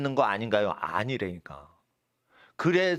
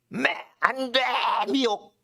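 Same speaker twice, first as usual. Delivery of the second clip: high, forceful cry of warning; emphatic warning; 7 semitones higher than usual. Acted. Preacher voicing scripture's warning against deception, emphatic warning. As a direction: proclaim each word as a high-pitched ban. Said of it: "이렇게 얘기하는 거예요".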